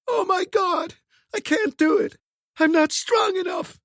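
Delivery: high, strained cry of panic; desperate cry. A narrator's reading. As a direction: cry out in despair. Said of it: "she gave a desperate cry".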